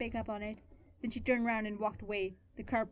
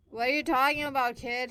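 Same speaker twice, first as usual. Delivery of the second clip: grumbling; 2 semitones higher, 6 dB louder than usual. A narrator's reading. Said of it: "he grumbled".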